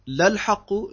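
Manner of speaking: لَلْحَقُّ pitched high and emphatic; Betonung auf لَلْحَقُّ. Shown as a demonstration. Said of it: "wieder eine Betonung". (لَلْحَقُّ hoch und nachdrücklich betont)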